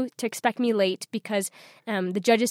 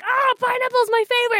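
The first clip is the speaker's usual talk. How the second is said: high-pitched gleeful exclamation; acted-out delight; 13 semitones higher than usual. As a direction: squeal it with delight, playfully overdone. Retold delight at seeing pineapples.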